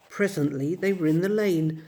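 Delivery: usual speaking voice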